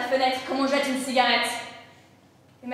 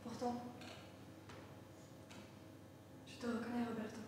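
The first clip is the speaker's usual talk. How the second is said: barely audible, much softer, lower; near whisper; 3 semitones lower, 23 dB quieter than usual. Acted. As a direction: breathe it out after the long pause. Mother's tender recognition after silence. (presque un murmure)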